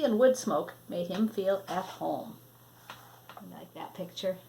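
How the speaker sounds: ordinary delivery, nothing marked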